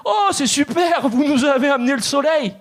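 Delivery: high, patronising Parisian gush; condescending Parisians. Retold or imitated. Parisians hearing the Marseille accent, high and patronising. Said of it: "ils me disent".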